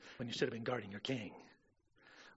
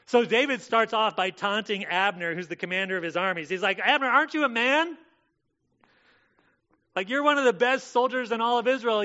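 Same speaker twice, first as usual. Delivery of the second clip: loud, high mocking challenge; taunting preacher; 7 semitones higher, 9 dB louder than usual. Preacher voicing David taunting Abner, loud and mocking. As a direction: taunt him from across the valley.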